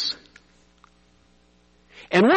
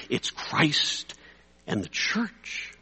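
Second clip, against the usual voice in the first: whispered, much softer, slowing on the church; hushed reveal; 8 dB quieter, much slower than usual. Acted. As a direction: breathe it out like a sacred secret. Preacher drops to a hushed reveal.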